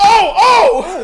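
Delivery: high, loud startled yelps; excited shout. Real, not acted. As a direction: shriek as the cork goes. Champagne bottle about to pop.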